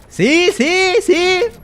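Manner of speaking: very high, loud squeaky "See?". Pretend. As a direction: chirp it up high and teasing.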